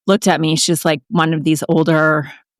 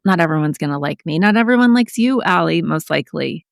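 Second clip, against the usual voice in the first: ordinary talk, nothing marked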